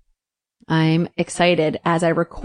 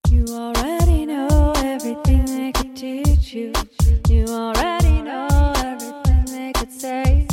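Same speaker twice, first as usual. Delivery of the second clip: dreamy spoken intro; 7 semitones higher, slower than usual. Acted. Poetic show intro over music.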